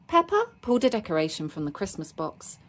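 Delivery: ordinary delivery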